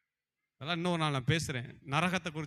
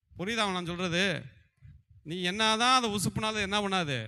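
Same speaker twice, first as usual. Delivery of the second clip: ordinary talk, nothing marked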